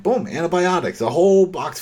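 spring the reveal like a punchline; excited reveal. Real, not acted. Host recapping the show to co-host, excited reveal.

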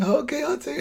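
high, caving-in kid voice, mock-pleading; Chunk from The Goonies. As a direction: squeak it out like a scared kid giving up. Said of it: "I was thinking of Chunk from The Goonies".

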